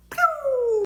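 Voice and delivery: high, loud exclamation "Pew"; surprised pew. Real, not acted. Surprised reaction sound.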